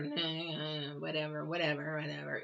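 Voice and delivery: low, soft, dismissive mumble. Retold or imitated. Tarot reader shows how her voice used to sound, low and dismissive. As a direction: drop the voice low and brush it off. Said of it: "I would speak lower".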